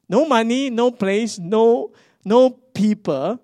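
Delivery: high, loud, each no hammered; emphatic rising list. Real, not acted. Pastor telling the church what they lacked, loud and emphatic. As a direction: pile the hardships on top of each other. Listing the struggles again.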